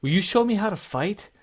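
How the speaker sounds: put-on an excited kid begging to learn to fight; little boy pleading with the warrior, high and fast; twelve-year-old boy. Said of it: "and he says".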